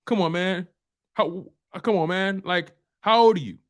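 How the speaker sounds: usual speaking voice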